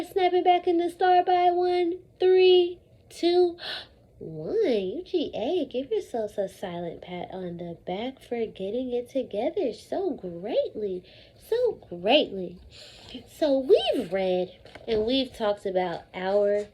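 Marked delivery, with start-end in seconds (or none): 4.2-12.6 hushed praise. Real, not acted. Calming class down after countdown.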